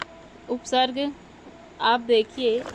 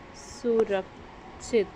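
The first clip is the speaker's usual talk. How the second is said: slow emphasis; 2 semitones lower, 5 dB quieter, much slower than usual. Shown as a demonstration. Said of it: "आप देखिए अब सिर्फ इस शब्द को".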